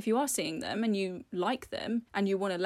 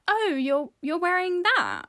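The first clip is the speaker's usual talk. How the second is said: judgy; 9 semitones higher, 5 dB louder than usual. Retold or imitated. Imitating a judgy date.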